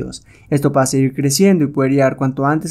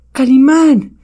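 high, loud cry of relief; overjoyed cry; 11 semitones higher, 6 dB louder, much slower than usual. Acted. Captive calls out to Calimán on seeing him arrive, overjoyed. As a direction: cry out to your rescuer.

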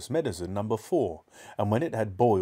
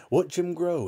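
raised pitch, eager call; excited cry; 8 semitones higher, 3 dB louder than usual. A narrator's reading. Mister Cranky awaiting the cockerel's growth, excited cry. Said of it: "cried Mister Cranky".

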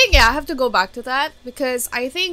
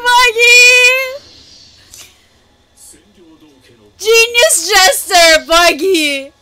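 much higher and louder, squealing with delight; ecstatic shouting; 8 semitones higher, 11 dB louder than usual. Real, not acted. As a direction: scream with joy at the screen. Reacts to a big episode reveal.

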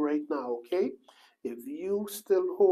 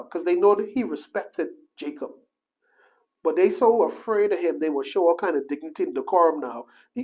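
ordinary talk, nothing marked

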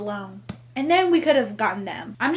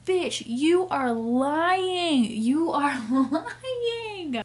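higher, drawn-out words with a laugh breaking in; exasperated accusation; 4 semitones higher, much slower than usual. Real, not acted. Reader calling out the lying heroine, exasperated. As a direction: stretch it out in exasperated disbelief.

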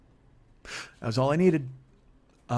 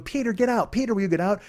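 put-on pleading girls; 8 semitones higher than usual.

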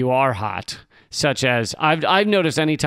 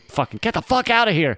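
quick, higher-pitched mock alarm; urgent command; 8 semitones higher, faster than usual. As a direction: bail out now, said fast. Brain voiced giving panicked order.

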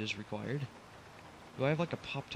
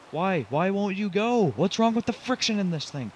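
real frustration, pitched up and loud; exasperated outburst; 6 semitones higher, 6 dB louder than usual. Real, not acted. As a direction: yell at the stubborn truck in exasperation. Truck will not climb the hill.

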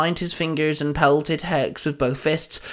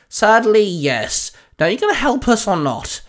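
raised, louder barked reply; 6 semitones higher, 4 dB louder than usual. A narrator's reading. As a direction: bark it impatiently at a higher pitch. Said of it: "Albedo barked".

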